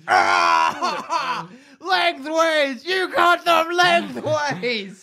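high laughing ogre scream. Acted. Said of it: "and screams".

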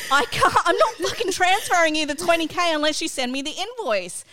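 replaying the row with real heat; retold angry refusal. Mum retelling the fight to her daughter, heated refusal. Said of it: "we're having this argument".

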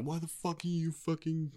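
mock dad shout. Dad confronting his kid at the door, mock high shout. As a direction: play a dad shouting, volume held back. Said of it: "like, shouts at him".

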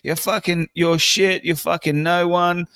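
high-pitched sideline sledging; mock taunting call-out; imitating heckling. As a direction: give a mate stick from the stands. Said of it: "Reece Walsh is giving it to him".

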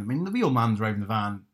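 ordinary delivery

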